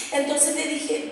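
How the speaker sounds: usual speaking voice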